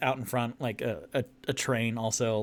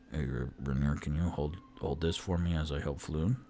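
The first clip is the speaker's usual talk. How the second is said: low character voice; 8 semitones lower, 4 dB quieter than usual. Acted. Character voiced asking Rainier.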